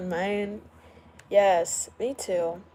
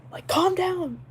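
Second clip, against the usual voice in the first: high, sassy telling-off; exasperated high-pitched calm down; 10 semitones higher, 4 dB louder, slower than usual. Real, not acted. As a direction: scold the noisy drivers from afar. Telling loud drivers off.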